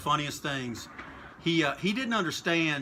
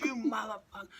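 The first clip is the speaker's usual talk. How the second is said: retold mock scream; 6 semitones higher, 5 dB quieter than usual. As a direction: imitate screaming across a ring, held back. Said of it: "he's screaming at me the whole".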